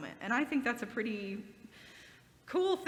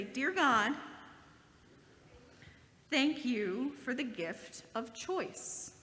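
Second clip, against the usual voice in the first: prayer; much slower than usual. Acted. Pastor leads the kids in a closing prayer, slow and gentle. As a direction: lead the children in prayer, phrase by phrase.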